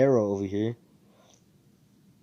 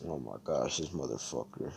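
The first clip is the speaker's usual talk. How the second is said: annoyed mutter; 6 semitones lower, 9 dB quieter than usual. Retold or imitated. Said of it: "Asta's just like".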